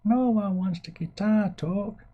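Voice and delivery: very high, slow silly voice; mock audience voice. Streamer mimics viewers refusing guitar talk, silly high voice. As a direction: draw it out in a put-on falsetto.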